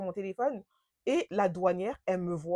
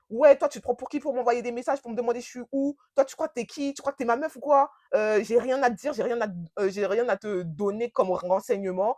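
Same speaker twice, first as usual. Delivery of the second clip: imitated angry tirade; 3 semitones higher, 5 dB louder than usual. Boyfriend laying into her on arrival, imitated angry rant. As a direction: lay into them, imitating an indignant boyfriend. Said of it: "Direct, il m'enchaîne".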